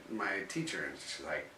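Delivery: normal talking voice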